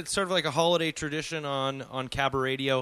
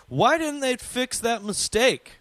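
imagined listener's question; 9 semitones higher, 4 dB louder than usual. Retold or imitated. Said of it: "you're thinking to yourself".

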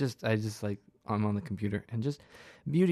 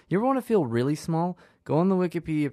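higher, louder rhetorical question; excited; 5 semitones higher, 5 dB louder, faster than usual. Real, not acted. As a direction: pitch it up and punch it. Rhetorical hook to listeners.

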